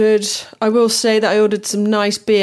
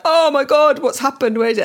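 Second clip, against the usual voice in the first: sudden panicked outburst, higher and louder; 6 semitones higher, 4 dB louder than usual. Retold or imitated. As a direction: panic that the link is missing. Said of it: "And I was like".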